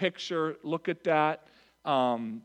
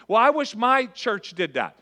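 higher, louder mock complaint; mock whiny churchgoer; 6 semitones higher, 7 dB louder than usual. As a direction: parody the grumbling member, pitched up. Mocking a congregant's complaint.

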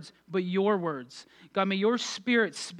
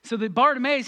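usual speaking voice

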